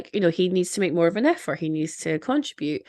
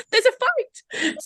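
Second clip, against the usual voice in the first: high, drawn-out cry of there's a fight; thrilled onlooker calling out; excited shout; slower than usual. Retold or imitated. Friends spotting the fight, excited cry.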